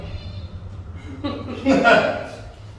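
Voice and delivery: high, breathy laugh; chuckle. Real, not acted. Preacher amused at his own giant story, chuckling. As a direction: laugh at your own aside.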